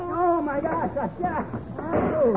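high, alarmed cry as the ladder goes; startled yelp. Acted. Man whose ladder is falling, high startled yelps. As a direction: caught off guard, voice jumps up.

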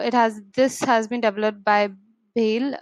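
normal talking voice

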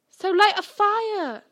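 high, loud, drawn-out shout; stretched, strained cry; desperate yell. A narrator's reading. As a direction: shout the urgent solution. Harry yells while being choked.

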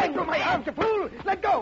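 high, straining angry yell; unmasked killer fighting his son's hold; furious struggle. Acted. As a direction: fight free of his grip. Killer wrestled by his son.

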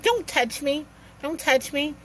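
high, upset pushing away; rebuffing the boyfriend's hug, upset and pitched high; upset refusal. Retold or imitated. Pushing boyfriend away after fight.

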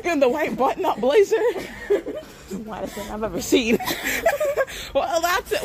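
talking through giggles; amused words broken up by laughter; laughing. Real, not acted. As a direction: crack up while teasing a friend. Cracking up at departing friend.

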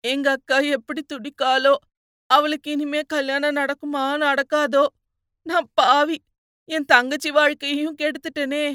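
grief-stricken, self-blaming cry; yearning lament. A narrator's reading. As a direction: lament a sister's ruined life. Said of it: "ஏங்கி ஏங்கி பேசினான்".

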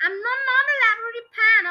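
high, loud, fast protest; cried out. A narrator's reading. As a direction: blurt it out in alarm. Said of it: "the husband cried".

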